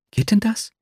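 high-pitched hopeful question; suddenly attentive. A narrator's reading. Nastjenka asks whether he could really go, suddenly attentive. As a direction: perk up, pitch leaping high. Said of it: "fragte sie plötzlich aufhorchend".

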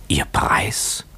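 whispered, rising question; shocked whisper. A narrator's reading. Young owner staring as at a ghost, shocked whisper. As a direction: echo it voicelessly, in disbelief.

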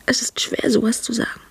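hushed, voiceless whisper. Acted. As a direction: whisper it shyly. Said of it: "deshalb ist deine Stimme so sanft".

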